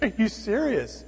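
retold exasperated disbelief; incredulous. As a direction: draw the question out as if astounded. Incredulous at woman asking for David.

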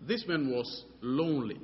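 usual speaking voice

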